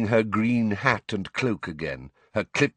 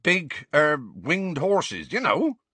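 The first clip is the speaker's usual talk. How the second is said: higher, hesitant gamekeeper explanation; 8 semitones higher, slower than usual. A narrator's reading. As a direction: explain hopefully to someone not following. Hagrid explains loudly to Umbridge.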